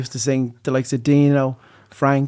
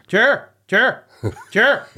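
imitating dad calling; 7 semitones higher, much slower than usual. Boy as dad calls down to mom, calling out. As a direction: call down like dad. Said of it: "then I called down to".

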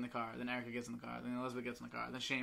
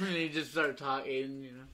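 usual speaking voice

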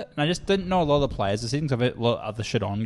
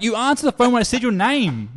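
higher and louder, disbelieving; exasperated; 9 semitones higher, 6 dB louder than usual. Retold or imitated. Arguing with a caller claiming wrong number, exasperated. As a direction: point out the obvious in frustration.

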